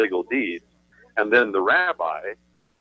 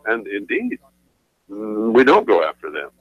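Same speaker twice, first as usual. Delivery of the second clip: usual speaking voice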